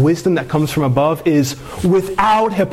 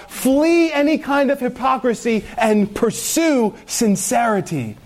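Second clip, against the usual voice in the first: higher, climbing pleading sermon voice; impassioned preaching; 8 semitones higher than usual. Acted. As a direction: press the congregation hard, rising high. Preacher building to fervent appeal.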